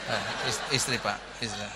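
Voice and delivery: quick, embarrassed, half-laughing correction; soft, sheepish mock husband; flustered laughing husband. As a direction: stammer it out, caught out and laughing.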